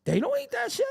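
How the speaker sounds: high, sneering put-on voice; high mocking scoff again; mock dismissive voice. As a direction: scoff at the food in a borrowed voice.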